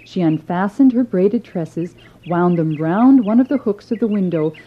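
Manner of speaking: plain reading voice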